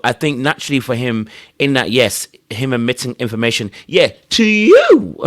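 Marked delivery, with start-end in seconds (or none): 3.9-5.2 high, drawn-out, louder "you"; mock dismissive reply. Imagined Jonathan answering his interrogator, mock dismissive. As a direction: stretch it out high and loud, mocking.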